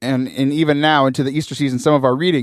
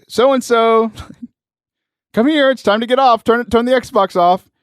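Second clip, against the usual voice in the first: lightly voiced mom, raised pitch; calling mom; 6 semitones higher than usual. Mom calls son off the Xbox, lightly imitated. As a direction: lightly imitate a mother calling her kid. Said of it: "I hear mom in the background".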